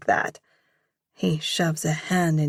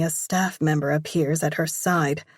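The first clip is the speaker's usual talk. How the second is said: ordinary delivery, nothing marked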